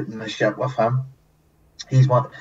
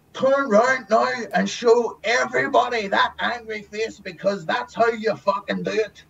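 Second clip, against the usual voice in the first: director commanding; 10 semitones higher than usual. Retold or imitated. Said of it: "He said".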